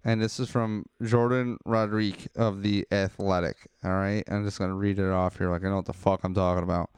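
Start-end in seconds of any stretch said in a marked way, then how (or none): none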